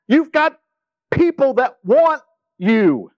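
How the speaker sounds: high-pitched exasperated nagging; mock-exasperated disciples pleading with Jesus to come back; annoyed disciples. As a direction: badger Jesus like frustrated, pushy followers. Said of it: "that they were annoyed".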